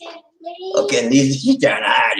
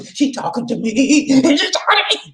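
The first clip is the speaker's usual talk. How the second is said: very high, delighted squeal; hammed-up thrilled admirer; mock giddy excitement; 12 semitones higher than usual. Man thrilled a lady answers him online, mock giddy squeal. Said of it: "And you go crazy".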